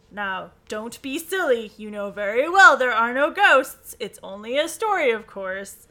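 loud, emphatic telling-off; scolding. Acted. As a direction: scold a silly friend with punchy stress. George scolds nervous Bess in story.

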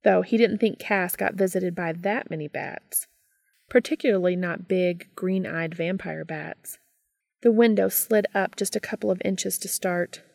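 usual speaking voice

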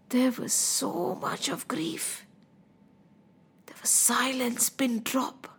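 very soft, slow near-whisper; stunned, grieving hush; hushed grief. A narrator's reading. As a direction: drop to a breath for the silence. Silence and grief after death.

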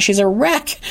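usual speaking voice

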